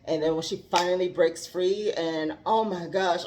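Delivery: ordinary talk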